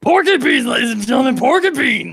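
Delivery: high, loud dinner call; big, high-pitched proclamation to the whole table; mock announcement. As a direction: holler it out like a playful announcer.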